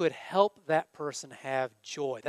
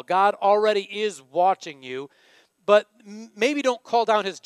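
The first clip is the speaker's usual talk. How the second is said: ordinary talk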